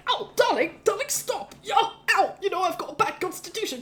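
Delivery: high-pitched yelping protest; pained pleading. Acted. Husband being hit with a ladle, pained pleading. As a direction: beg for mercy between blows.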